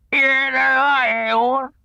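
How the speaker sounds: very slow, garbled through a mouthful; mouth full. A narrator's reading. Tigger answers Pooh and Piglet with his mouth full. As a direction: chew the words round the acorns. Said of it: "his mouth was full of acorns".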